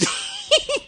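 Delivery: high squealing giggle; high laugh. Real, not acted. Pastor laughing about the thief story, high giggle. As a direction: let out a high, amused laugh.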